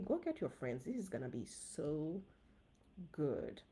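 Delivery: ordinary delivery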